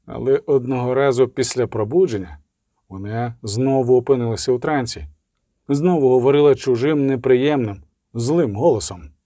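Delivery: plain reading voice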